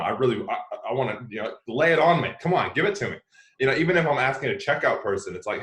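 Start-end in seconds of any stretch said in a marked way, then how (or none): 1.7-3.2 loud and eager, lay it on me; eager invitation. Acted. A pal, eager to hear how you're really doing. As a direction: urge them to spill it all.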